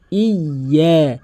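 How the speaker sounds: "ইয়া" slowly, y held double; doubled-letter pronunciation. Shown as a demonstration. Teacher demonstrating tashdid, slow and emphatic. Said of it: "তাসদিদের উচ্চারণ double উচ্চারণ হয়".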